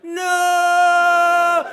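long, high, loud drawn-out "no"; hammed-up slow-motion shout; slow-motion scream. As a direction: stretch it out like a movie hero. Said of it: "I'm in slow motion".

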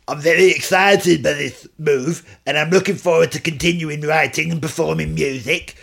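lightly put-on, not very Scottish accent; a hint of the Scottish singer, half-hearted. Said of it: "It's not even Scottish".

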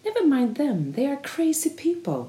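high, sweet, sing-song voice cooing to dogs; a beach man sweetly telling his dogs the others are crazy; kind friendly tone. Retold or imitated. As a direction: mockingly coo like a man soothing his pets. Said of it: "pratar han så här i snäll och vän ton till de här hundarna".

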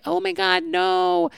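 very high, tiny squeaky cry of alarm; panicked cartoon crabs. Cartoon crabs as the rain starts, squeaky mock panic.

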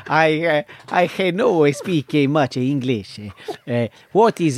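slow, halting mock Spanish accent; wobbly imitation of a Spanish speaker; put-on Spanish accent. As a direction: fumble along in a badly faked Spanish accent. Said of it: "He's trying to speak in a Cavan Spanish accent".

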